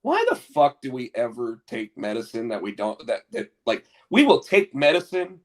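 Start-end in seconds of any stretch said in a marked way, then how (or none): none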